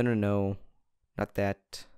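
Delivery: normal talking voice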